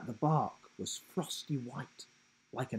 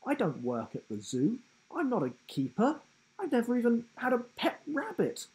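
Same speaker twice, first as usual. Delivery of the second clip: rising, higher flustered denial; flustered protest; 10 semitones higher, faster than usual. A narrator's reading. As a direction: protest in a panic. Will protests.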